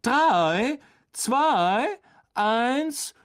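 high, drawn-out shouted count; called-out countdown. A narrator's reading. Teacher starting a duel, calling the countdown. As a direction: call each number out, loudest on the last. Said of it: "rief er".

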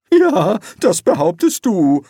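reply seriously, not convinced; earnest doubt, pitch up. A narrator's reading. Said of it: "erwiderte der Zauberer ernst".

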